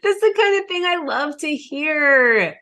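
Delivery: high, drawn-out, laughing delight; real delight. Real, not acted. Host reacts to coach's success story, delighted. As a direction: gush with pitch soaring way up.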